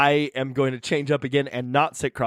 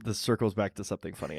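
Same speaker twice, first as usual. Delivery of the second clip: ordinary talk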